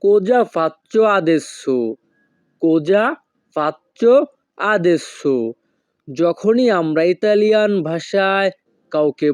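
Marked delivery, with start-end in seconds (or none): 0.0-2.0 very slow, whole phrase in one clear line; slow teaching demo. Shown as a demonstration. Teacher to viewers, slow clear model phrase. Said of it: "এই বাক্যের Italian ভাষা হবে". 2.6-5.4 each word alone with a pause, rising then falling on adesso; word by word drill. Shown as a demonstration. Teacher to viewers, separated word-by-word repetition. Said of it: "এই বাক্যের Italian ভাষা হবে".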